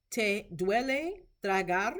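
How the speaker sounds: "¿Te duele tragar?" slow and careful, rising at the end; rising question tone. Shown as a demonstration. Teacher showing clinicians how to ask, "tragar?" rising slowly. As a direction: model the question, lifting "tragar?" upward.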